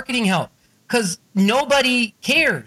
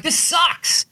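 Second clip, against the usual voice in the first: fired up; 11 semitones higher than usual. Retold or imitated. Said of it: "he had me all fired up".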